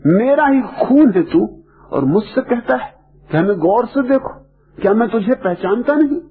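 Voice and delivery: imitating an indignant father, pitched high; retold fatherly anger, high and insistent; angry father. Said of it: "गुस्से में आ गए कि".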